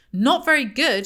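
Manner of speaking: high, loud incredulous echo; angry shout. A narrator's reading. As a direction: throw "Not very good?" back at the wife, shouting. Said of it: "he shouts".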